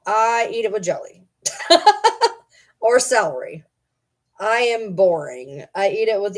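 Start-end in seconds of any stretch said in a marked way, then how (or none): 1.4-2.3 squealing high laugh; loud squeal of a laugh. Real, not acted. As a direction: burst into a high giggle. Laughs mid sentence.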